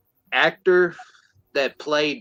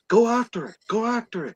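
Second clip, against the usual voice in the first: urgent orders; 9 semitones higher, faster than usual. Retold or imitated. Acts out crowd ordering a chase.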